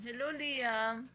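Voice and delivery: "Hello, Liam" drawn out slow with a rising end; slow phone hello. Retold or imitated. Mom imitates the toddler phoning big brother, slow drawn-out hello. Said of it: "Nausap yung kuya niya sa cellphone".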